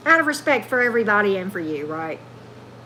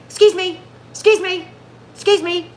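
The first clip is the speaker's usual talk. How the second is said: repeated excuse me, pitched up and louder; mock Tyler excuse me; 7 semitones higher, 5 dB louder than usual. Tarot reader imitating Tyler interrupting, higher and louder. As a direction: mimic him cutting in, higher and louder. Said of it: "when he goes".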